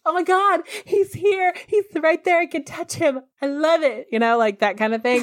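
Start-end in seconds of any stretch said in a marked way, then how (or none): 0.1-4.1 high, excited fangirl gush; a higher, breathless swoon over a crush; giddy starstruck gush. Retold or imitated. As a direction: gush like a starstruck teen seeing her crush. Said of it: "looking at him like".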